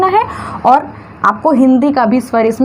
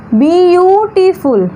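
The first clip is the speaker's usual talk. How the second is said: "Beautiful" stretched slowly, higher than usual; drawn-out pronunciation; 4 semitones higher, much slower than usual. Shown as a demonstration. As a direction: draw out the vowels of "beautiful". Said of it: "तो इसका pronunciation देखिए".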